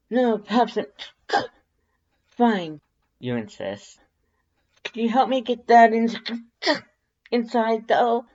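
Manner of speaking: high voice broken by coughs; coughing through dust. A narrator's reading. The dusty human asks Papyrus for help, coughing and high. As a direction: push the words out between coughs.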